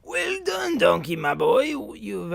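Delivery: high, put-on old-timer voice; old man character. Player reading the ending text, crotchety old man voice. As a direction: voice a proud cranky old ape. Reads Cranky's dialogue in character.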